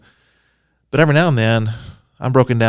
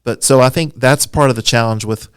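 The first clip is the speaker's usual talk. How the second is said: ordinary talk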